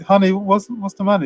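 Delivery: a hint of wifely concern; lightly voiced wife. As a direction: ask like a wife puzzled by her husband. Voicing Leo's worried wife.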